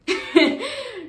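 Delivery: a quick bright chuckle; short high laugh. Retold or imitated. Laugh after reassuring Oli. (risa breve y aguda)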